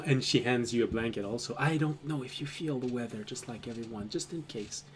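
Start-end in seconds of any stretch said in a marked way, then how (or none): 1.6-4.8 very soft, fading toward a murmur; gentle night-time undertone; hushed offer. Acted. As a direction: offer it quietly so nobody wakes. Sashay quietly hands over a blanket.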